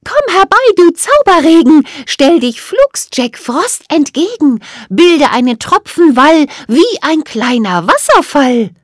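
high, loud fairy chanting; magic spell incantation. A narrator's reading. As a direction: recite a rhyming spell in a high voice. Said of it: "hoben sie gemeinsam ihre Zauberstäbe und sagten".

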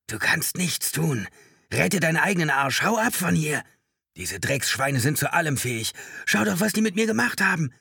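high desperate shouting, building to a scream; agonized panic. A narrator's reading. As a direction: cry out in mounting agony. Crazed man in agony, screaming.

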